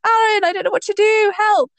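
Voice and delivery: high-pitched retold panic; freaking out. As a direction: cry for help in a comic flap. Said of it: "I was, like, freaking out".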